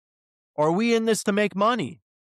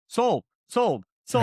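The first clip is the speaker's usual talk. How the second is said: heated, emphatic repetition; yelling at co-host; 4 semitones higher, slower than usual. Real, not acted. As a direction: drive each word home in real irritation. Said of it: "'cause you're yelling at me".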